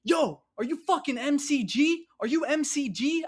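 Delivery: high, loud mock yell of the gunman; imitating a yell. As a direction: bark it out high and loud like a threatening stranger. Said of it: "he yells at me".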